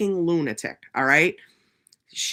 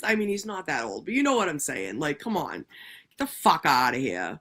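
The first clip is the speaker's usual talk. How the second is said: ordinary talk, nothing marked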